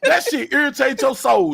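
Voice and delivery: high and loud through laughter; loud, high laughing demand; laughing appeal. Real, not acted. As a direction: laugh while pressing him.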